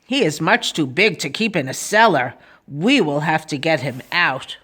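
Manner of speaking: low-pitched officer's verdict; policeman. Acted. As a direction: speak deep, as the policeman. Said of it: "he said".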